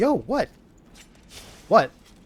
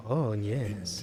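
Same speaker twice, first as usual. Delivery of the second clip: sensual voice; 7 semitones lower, 12 dB quieter than usual. Acted. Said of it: "I turned this into something sensual".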